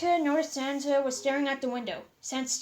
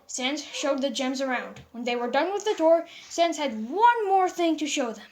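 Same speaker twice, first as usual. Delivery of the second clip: plain reading voice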